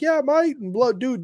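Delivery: high-pitched imitation British accent; son's British accent. Dad mimicking his son on Fortnite, high mock British accent. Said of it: "talking with this British accent to the T".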